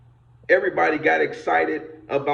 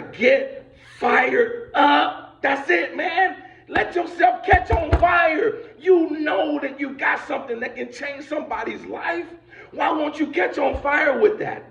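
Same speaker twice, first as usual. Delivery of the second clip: fired-up shout; 8 semitones higher than usual. Real, not acted. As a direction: whip the crowd into fire. Preacher climax urging listeners.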